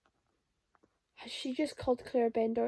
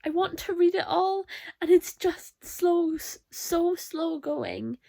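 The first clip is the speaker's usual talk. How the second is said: louder, higher, slowing on slow going; real exasperation building to a drawn-out whine; exasperated; 5 semitones higher, 6 dB louder, slower than usual. Real, not acted. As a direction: vent real frustration, dragging the words. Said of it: "it's annoying me".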